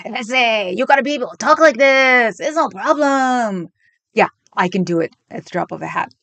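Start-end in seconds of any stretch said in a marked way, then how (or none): 0.1-3.7 mock Mexican accent. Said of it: "not know how to do a Mexican accent".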